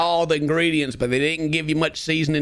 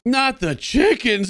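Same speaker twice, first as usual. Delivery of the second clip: higher, slow drawn-out wail; dramatic protest; 6 semitones higher, much slower than usual. Real, not acted. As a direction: stretch out chickens in mock despair. Dramatic repeat of the same protest.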